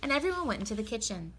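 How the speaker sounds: ordinary delivery, nothing marked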